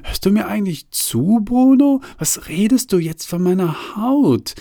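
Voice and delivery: hoch und empört, Name lauter hochgerissen; gereizt, den Tränen nah fragen; irritiertes Schluchzen. A narrator's reading. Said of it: "reagiert völlig irritiert darauf und schluchzt". (gereizt schluchzend, hohe Stimme)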